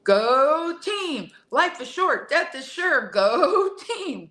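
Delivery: high, peppy cheerleader chant. Shown as a demonstration. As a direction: cheer it like a pep rally. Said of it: "I wanna just say it like a cheerleader".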